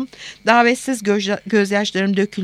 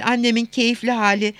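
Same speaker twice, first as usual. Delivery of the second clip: usual speaking voice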